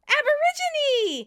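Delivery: very high, loud, stretched yell; shouted guess. Retold or imitated. Rachel calling out from the background, high eureka shout. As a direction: holler it in sudden triumph. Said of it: "Rachel in the background shouts".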